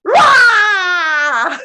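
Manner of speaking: loud, high full-force roar; big theatrical animal roar; tiger roar. Shown as a demonstration. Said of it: "Let's do it again".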